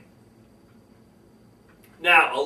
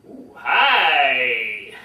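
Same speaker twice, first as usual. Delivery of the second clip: low, drawn-out "Ooh, hi"; ooh, then a long sliding "hi"; changed tone hi; 6 semitones lower, 4 dB louder than usual. Shown as a demonstration. Speaker showing how tone changes meaning, drawn-out low "Ooh, hi". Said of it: "Or you could say".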